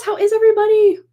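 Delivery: high, loud call to viewers; excited greeting. Real, not acted. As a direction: call out to everyone happily.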